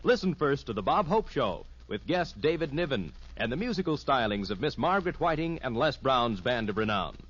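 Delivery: usual speaking voice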